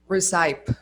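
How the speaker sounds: recipe said as 're-SIPE'; learners' mispronunciation. Shown as a demonstration. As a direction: rhyme the end of recipe with 'type'. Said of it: "They say".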